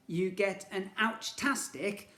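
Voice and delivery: ordinary delivery, nothing marked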